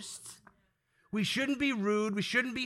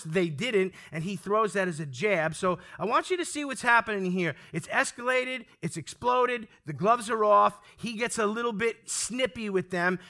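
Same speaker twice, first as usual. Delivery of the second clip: ordinary delivery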